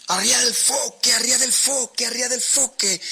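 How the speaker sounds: high, loud shouted order over the storm; captain's shout. A narrator's reading. Captain shouting orders to desperate sailors in a storm, loud high shout. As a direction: bellow the command to the crew above the wind. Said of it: "el capitán le gritaba a sus marineros desesperados".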